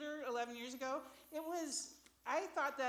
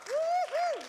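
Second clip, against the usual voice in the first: high, loud woo-hoo; big cheering holler; excited whoop; 11 dB louder than usual. Real, not acted. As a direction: whoop it up for the crowd. Host cheering on the act.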